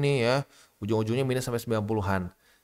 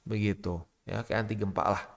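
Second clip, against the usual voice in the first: normal talking voice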